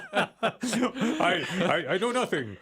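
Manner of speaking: mock-foreign accent, cracking up mid-line; half-laughing, hammed-up accent like a Chosen actor; laughing put-on accent. Newcomer to the faith, in a laughing put-on accent. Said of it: "I sound like I'm trying out for The Chosen".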